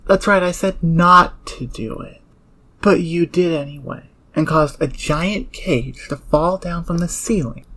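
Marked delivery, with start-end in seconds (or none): 0.1-4.0 louder, cutting rebuke; angry raised voice. Acted. Star berating the newcomer for pressing the button, loud and angry. Angry peak of her scolding. 4.4-6.8 sarcastic scolding. Acted. Berating newcomer for pressing buttons.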